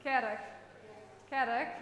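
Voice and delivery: Kerk said firmly in Dutch, clipped final k; Dutch pronunciation. Shown as a demonstration. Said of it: "how are we gonna pronounce it in Dutch".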